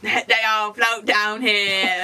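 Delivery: slow, drawn-out creepy parody; mock clown voice. Echoing a friend's killer-clown quote, slow mock-spooky voice. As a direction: draw every word out spookily. Imitating the clown line back.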